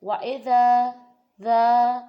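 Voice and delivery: وَإِذَا with ذا held one alif, loudly; loud recitation. Shown as a demonstration. Teacher demonstrating alif length on وَإِذَا. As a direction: sing out ذا and hold it briefly. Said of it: "اتنا سا، ٹھیک ہے".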